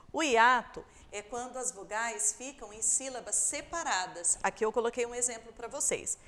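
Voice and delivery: usual speaking voice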